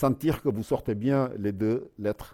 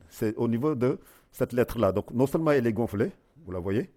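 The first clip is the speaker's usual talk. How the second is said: ordinary talk, nothing marked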